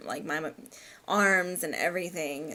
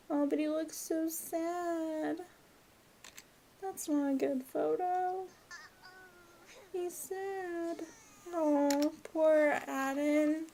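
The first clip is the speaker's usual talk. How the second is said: pitying coo; 8 semitones higher, 4 dB quieter, slower than usual. Acted. Pitying the sad toddler.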